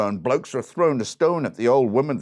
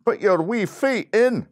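put-on loud, high Scottish accent; indignant Scottish bandsman calling out; Scottish bloke; 7 semitones higher, 6 dB louder than usual. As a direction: warn forcefully in a broad Scottish voice. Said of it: "one of the Scottish blokes in the Scottish band".

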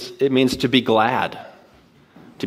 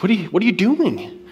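imitating a grumpy neighbor, pitched up; higher-pitched retold grumpy demand; 9 semitones higher than usual. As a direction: challenge him in a higher, mock-grumpy voice. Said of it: "she was kinda grumpy".

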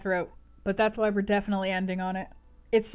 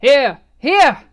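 very loud, raised call; answering shout; 5 semitones higher, 15 dB louder than usual. Acted. Host answers the searching guests, big answering shout. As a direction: shout back across the hall. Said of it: "was shouted in return".

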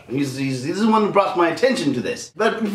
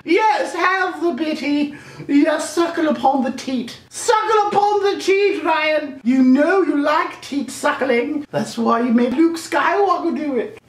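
high, slow domineering queen; evil queen voice; 10 semitones higher, slower than usual. Pretend. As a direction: lord it over them from the throne, much higher. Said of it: "like the queen".

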